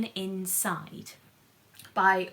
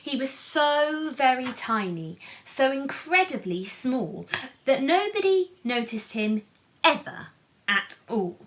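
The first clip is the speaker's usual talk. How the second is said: higher, louder lively read-aloud; storybook narration; 5 semitones higher, 5 dB louder than usual. A narrator's reading. Reading the picture book aloud to children, animated. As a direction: lift the story up for the little ones.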